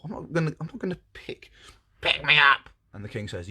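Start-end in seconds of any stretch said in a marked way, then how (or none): 0.0-1.5 high, soft princess protest; refusing princess. Acted. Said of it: "And she goes". 2.0-2.7 loud, high-pitched frog interruption; demanding frog. Acted. Frog interrupts, repeating his demand.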